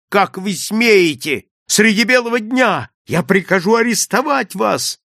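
outraged, high-pitched threats; furious shout. A narrator's reading. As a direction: cry out in indignant rage. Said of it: "не помня себя от ярости".

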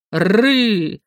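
протяжный рык клыкастого эльфа; рыкни, оскалив клыки; звериный рык. Acted. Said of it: "оскалил внушительные клыки". (угрожающий рык)

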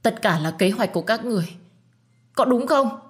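demanding, voice climbing on the question. A narrator's reading. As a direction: confront them, pitch rising. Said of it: "cao giọng chất vấn".

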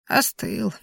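soft, mild grumble; quiet little gripe; complaining. A narrator's reading. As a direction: complain softly, mildly put out. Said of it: "пожаловалась она".

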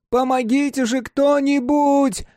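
high, slow drawn-out yell; shouting for help. A narrator's reading. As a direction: stretch the cry for help. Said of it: "Крики Марка".

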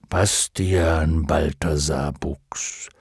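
slow, low dragon voice; almost solemn. A narrator's reading. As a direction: address him gravely, drawing out the name. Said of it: "es klang beinahe feierlich". (beinahe feierlich, tief und langsam)

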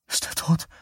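breathless whispered question; mother asks about her son, whispered in fear; frightened whisper. Acted. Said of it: "fragte Vera".

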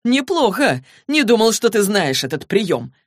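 high, loud, amused exclamation without laughter; laughing praise. A narrator's reading. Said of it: "расхохотался".

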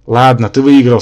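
loud, relieved concession; bursting out after enforced silence; relieved exclamation. A narrator's reading. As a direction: blurt out surrender with relief. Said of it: "с явным облегчением воскликнул Рабан".

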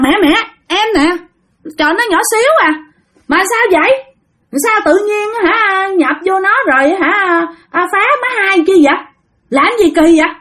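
high spirit voice, questioning; possessed aunt's changed voice. Acted. Spirit in aunt asks why the little one bothers, changed high voice. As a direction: scold lightly in a high possessed voice. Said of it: "cái giọng của dì Năm thay đổi liền".

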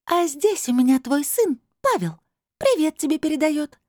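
high, sly otherworldly voice; insinuating crystal voice. A narrator's reading. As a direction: drop the news insinuatingly, pitched high. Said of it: "вкрадчиво молвил голос". (высоким вкрадчивым голосом)

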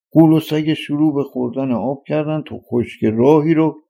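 usual speaking voice

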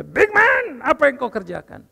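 a lightly voiced booming call; God calling. God summoning the preacher, lightly voiced loud call. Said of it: "Tuhan panggil saya menghadap dia".

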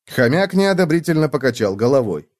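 ordinary delivery

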